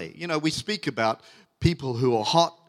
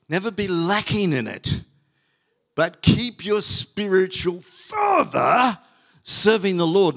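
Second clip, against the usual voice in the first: emphatic scripture reading; 3 semitones higher, slower than usual. Acted. Pastor to congregation, slow emphatic scripture reading. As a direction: lean on every word as if from the pulpit.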